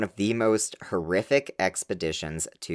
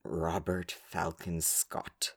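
slow, low, much softer mock-reverent name; hushed grand name; 3 semitones lower, 10 dB quieter, slower than usual. Shown as a demonstration. Repeating the explorer's name to her co-host, hushed and slow. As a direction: breathe the name like a solemn legend. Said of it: "they all have to be like this".

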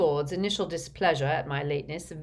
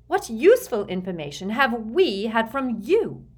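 high, louder, scornful demand; derisive, raised-voice challenge; sneering; 6 semitones higher, 7 dB louder than usual. A narrator's reading. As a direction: lean on the words with contempt. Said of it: "sneered Bellatrix".

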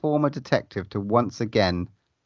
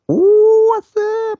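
excited squeal; 6 dB louder, much slower than usual. Real, not acted. Excited reaction to cast name.

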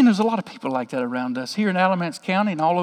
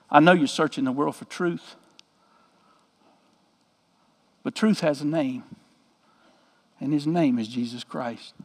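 tender witness; 4 semitones lower, 8 dB quieter than usual. Retold or imitated. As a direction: lay it on her gently. Witnessing to the crying guide.

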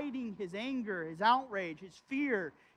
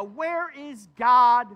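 loud anguished cry; 3 semitones higher, 13 dB louder, much slower than usual. Retold or imitated. Preacher voicing David's despair.

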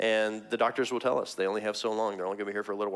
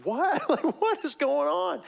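high-pitched, drawn-out disbelief; bewildered; slower than usual. Acted. Reacting to a horrible tragedy, bewildered. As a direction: act out stunned bafflement, slow and high.

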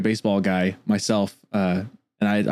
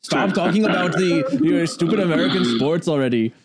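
mock German English, pitched up and slowed; badly faked German accent; 6 semitones higher than usual. Scolding Americans as a European fan, bad German accent. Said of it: "That's my really bad, uh, German English accent".

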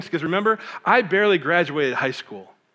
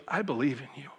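tender reassurance; 9 dB quieter than usual. Retold or imitated. Wife comforting her crying husband, softly reassuring. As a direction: quietly lift him up. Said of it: "She goes".

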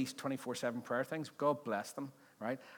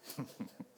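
breathy laugh; 7 dB quieter than usual. Real, not acted. Pastor laughs at own joke.

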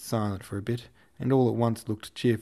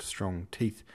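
ordinary delivery

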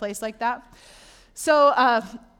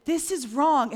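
louder, higher, firm rebuke; a firm, raised rebuke; forceful rebuke; 6 semitones higher, 4 dB louder, slower than usual. Retold or imitated. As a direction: push back hard against it. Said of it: "But if I'm like".

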